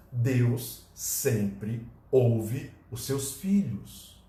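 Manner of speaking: tender, drawn-out assurance; solemn, slow. Acted. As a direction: let each word land gently.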